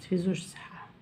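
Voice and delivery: hushed reassuring whisper. Real, not acted. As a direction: whisper it as a quick aside. (همس مطمئن)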